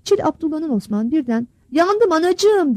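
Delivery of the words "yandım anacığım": high, drawn-out cry of pain; a hand clawed open, crying out for mother; pained shout. A narrator's reading. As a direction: yell slowly for your mother, hurt. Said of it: "diye bağırdı".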